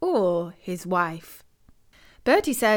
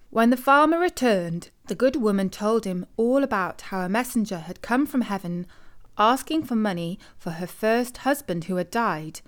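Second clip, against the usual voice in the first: ordinary delivery, nothing marked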